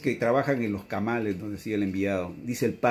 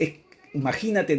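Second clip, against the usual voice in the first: usual speaking voice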